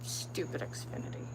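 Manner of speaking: under-the-breath whisper of disgust; whispered grumble. Real, not acted. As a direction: hiss it to yourself, annoyed. Muttering to herself again.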